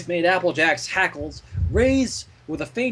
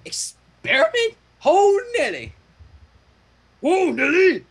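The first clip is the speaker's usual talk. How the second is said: alarmed Applejack; 13 semitones higher than usual. Acted. Applejack yelping in alarm at the braiding. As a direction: yelp it out.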